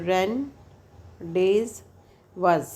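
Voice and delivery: रन डेज वज, each part sounded separately as written; spelling-style reading of रन डेज वज, with gaps; read as spelt. Shown as a demonstration. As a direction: say रन, डेज, वज one chunk at a time, with the written consonants. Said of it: "तो मैं बच्चों को ऐसे बताती हूँ".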